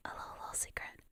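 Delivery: very soft whisper; teasing whisper. Pretend. Streamer luring chat to listen, secretive whisper.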